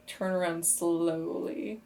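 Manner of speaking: normal talking voice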